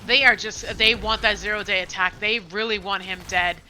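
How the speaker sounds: loud hype call; excited play-by-play. Real, not acted. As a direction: push the excitement. Hyped fight commentary.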